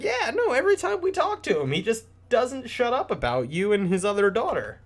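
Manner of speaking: very high, fast excited gossip; squeaky townsperson. Retold or imitated. A townsperson tells Ophelia of her father, very high and excited. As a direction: chatter it out in a tiny high voice. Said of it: "And it's like".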